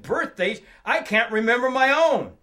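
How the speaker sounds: loud, rising mock exasperation; hearty, self-mocking outburst; comic exclamation. Man jokes back about his failings.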